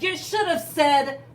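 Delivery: loud, rollicking pirate cry. A narrator's reading. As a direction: call it out after a man overboard. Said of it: "Pete cried".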